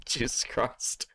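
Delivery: breathless laugh, whispered curse. Real, not acted. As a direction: laugh it out under your breath. Laughing at the clip.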